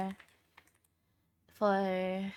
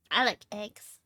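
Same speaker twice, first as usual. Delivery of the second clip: ordinary talk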